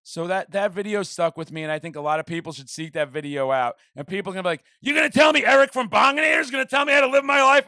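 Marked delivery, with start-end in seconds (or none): 4.8-7.7 loud, high, incredulous mock shout; indignant objection. Imagined sceptic objecting to Eric's advice, indignant shout.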